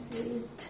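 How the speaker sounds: whispered t with the tongue on the teeth; soft t. Shown as a demonstration. Teacher modelling the soft t. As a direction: tap t softly. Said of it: "very softly".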